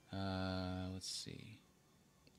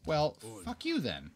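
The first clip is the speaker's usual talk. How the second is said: mock angry; 9 semitones higher, 5 dB louder than usual. Reacting to AI refusal.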